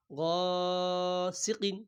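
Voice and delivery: slow chanted গাসিকিন; long, sung-out গাসিকিন; drawn-out recitation. Shown as a demonstration. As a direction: stretch গাসিকিন like tilawat. Quran reading drawn out.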